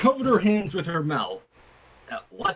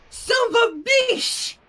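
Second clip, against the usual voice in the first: put-on high, loud toddler voice; proud toddler; 13 semitones higher, 4 dB louder than usual. A narrator's reading. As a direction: say it proudly like a little child. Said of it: "Flurry stated proudly".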